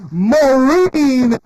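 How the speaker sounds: slow, stretched, shouted introduction; announcer intro. Acted. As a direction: call the name out like a ring announcer. Host introduces next performer.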